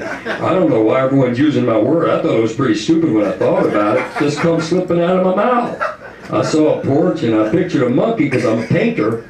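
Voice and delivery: puzzled man defending his word; lightly voiced inventor. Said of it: "he's like".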